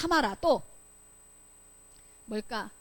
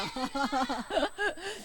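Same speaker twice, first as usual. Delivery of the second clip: real chuckle; short burst of laughter; amused laugh; 4 dB quieter than usual. Real, not acted. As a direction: laugh it off lightly. Laughing at blocked view.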